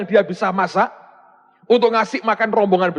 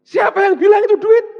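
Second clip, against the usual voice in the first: loud challenge; 13 semitones higher, 7 dB louder than usual. Real, not acted. Preacher challenging the congregation.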